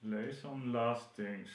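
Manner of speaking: talking around pins held in the lips; mumbling with pins in mouth. Real, not acted. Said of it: "Shall I not talk with a pin in my mouth?".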